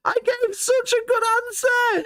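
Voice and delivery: high laughing wail; anguished shouting. Real, not acted. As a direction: wail in mock despair. Cut out again.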